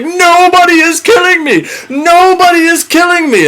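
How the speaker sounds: high, loud mock cyclops wailing; blinded cyclops crying for help, hammed-up; panicked shout. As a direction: yell it high and desperate. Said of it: "And Polyphemus is like".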